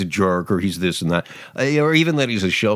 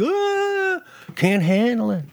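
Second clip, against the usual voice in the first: high, strained, whiny give-up; overwhelmed Comey; slower than usual. Retold or imitated. Host mocks Comey as overwhelmed, with a high whiny wail. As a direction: wail that it is all too much. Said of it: "And Comey's like".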